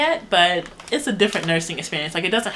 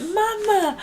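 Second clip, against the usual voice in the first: put-on much higher, squealed baby call of mama; 14 semitones higher than usual. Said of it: "and then she went".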